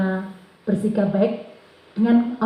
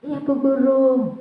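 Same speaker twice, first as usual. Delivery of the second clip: put-on high, slow child's reply; murid senang; 4 semitones higher, slower than usual. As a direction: imitate a pleased pupil answering slowly. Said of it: "Wah, wajahnya seneng ya dia". (suara anak senang, pelan dan tinggi)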